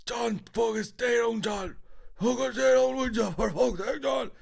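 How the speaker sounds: high, loud repeated yelling; a man screaming at John to stay down; imitated shout. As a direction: bellow the order over and over. Same man shouting retold.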